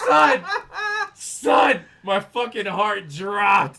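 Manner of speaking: very loud, high-pitched outburst; shouted exclamation. Real, not acted. Storyteller to his co-host as his heart drops, shouting. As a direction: yell it at the story's climax.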